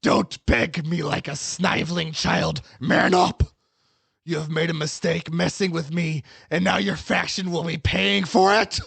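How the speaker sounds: menacing scolding that climbs in pitch; scornful villain. Acted. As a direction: berate a weakling, rising to a shout. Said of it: "Dead sighs and says".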